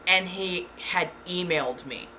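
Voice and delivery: usual speaking voice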